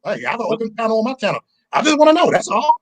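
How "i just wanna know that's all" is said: exasperated. Real, not acted. Heated argument on panel.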